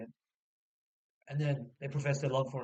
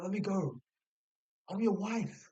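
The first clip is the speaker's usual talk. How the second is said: higher-pitched imitation of pleading; acted insistence; 6 semitones higher, slower than usual. Wife asking husband to let her come, acted insistence. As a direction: press the case as a mock-indignant wife.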